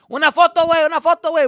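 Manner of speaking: loud, high-pitched mock pestering; nagging for photos. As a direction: call out insistently for a picture.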